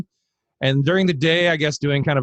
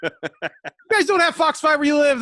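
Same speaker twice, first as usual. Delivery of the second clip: high, drawn-out mock astonishment with a laugh; Joshua; 11 semitones higher than usual. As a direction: imitate a baffled friend, voice pitched up, half laughing. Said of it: "Anytime Joshua talks about the Fox channel".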